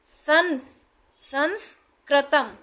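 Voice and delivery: संस्कृतम slowed, stressing the न sound in संस; slow demo. Shown as a demonstration. Teacher showing the न sound of anusvara in संस्कृतम. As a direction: draw out संस- then say संस्कृतम. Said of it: "ये हमेशा ना का sound देगा".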